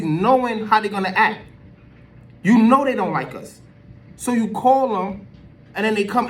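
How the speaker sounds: loud, high-pitched exasperated argument; worked-up rant. Real, not acted. Commentator scolding those who called the police, worked up and loud.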